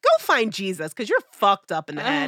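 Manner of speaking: loud, high telling-off; retold telling-off of the ex, loud and high; telling him off. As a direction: tell him off for good.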